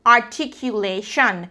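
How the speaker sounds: ARTICULATION said syllable by syllable; carefully articulated. Shown as a demonstration. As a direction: give each syllable of ARTICULATION its own beat. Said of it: "pronounce each and every syllable".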